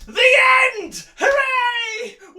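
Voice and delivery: high, loud celebratory shout; triumphant cheer. Real, not acted. Reader celebrating the end of the novel, triumphant cheer. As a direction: draw it out and whoop.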